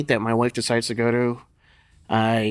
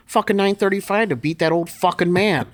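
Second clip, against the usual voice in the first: cocky boast; 7 semitones higher than usual. Retold or imitated. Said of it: "I said".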